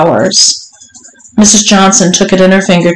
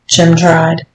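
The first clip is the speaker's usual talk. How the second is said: usual speaking voice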